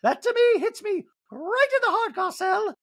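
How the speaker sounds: very high, affected impression voice; wounded protest in a piercing high put-on voice; Dorit. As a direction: pitch way up, stagey and hurt. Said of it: "Dorit in this scene goes".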